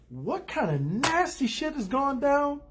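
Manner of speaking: high, slow, drawn-out exclamation; mock astonishment. Recapper reacts to a scandalous plot turn, drawn-out mock astonishment. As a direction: stretch the question out high in amazement.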